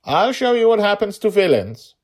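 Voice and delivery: high, loud vow; fierce threat. A narrator's reading. Girl threatens the goblin monster, loud fierce threat. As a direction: promise him a beating.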